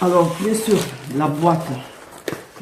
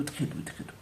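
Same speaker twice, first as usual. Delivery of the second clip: breathy whisper, much softer, trailing off; 5 semitones lower, 14 dB quieter than usual. Real, not acted. To viewers while stroking the soft rug, whispered.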